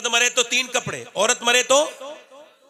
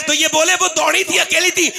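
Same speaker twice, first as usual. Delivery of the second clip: preaching at full force; 8 semitones higher, 4 dB louder than usual. Acted. Husband's reply voiced.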